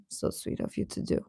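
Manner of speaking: whispered thanks; touched whisper. Real, not acted. Whispering thanks to Antonia in the chat. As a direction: breathe it out softly, moved.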